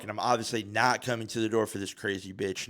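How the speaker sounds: ordinary talk, nothing marked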